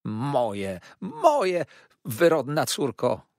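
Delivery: very high, loud shriek of wounded pride; mother crying out at her ungrateful daughter; outraged cry. Acted. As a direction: wail it, scandalised. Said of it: "wołała pani Merkelham".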